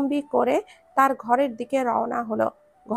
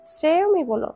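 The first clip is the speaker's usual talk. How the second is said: ordinary delivery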